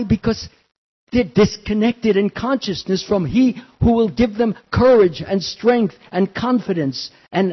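fervent sermon delivery, building; preaching. Acted. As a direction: preach it to the congregation. Preaching the moral lesson.

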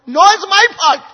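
very high, loud outburst; emphatic self-blame. Real, not acted. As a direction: cry out the admission at full voice. Answers own question emphatically.

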